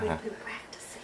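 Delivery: hushed whisper; whispering over a crib. Real, not acted. Whispering near sleeping baby.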